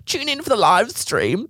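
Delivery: high, slow, sing-song mock influencer voice. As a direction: lampoon a breathless influencer promo, slow and high. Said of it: "all these influencers being like".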